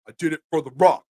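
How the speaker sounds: lightly hammed-up wrestler promo line; wrestling catchphrase impression. Host playing wrestler for the chat, mock wrestler catchphrase. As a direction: toss off the catchphrase like a wrestler on the mic. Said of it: "that's my wrestling impersonation for the day".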